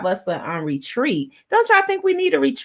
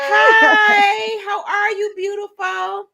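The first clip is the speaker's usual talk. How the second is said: high squealed hello to the guest; excited greeting; 11 semitones higher, slower than usual. Real, not acted. Host greeting the arriving guest with a squeal.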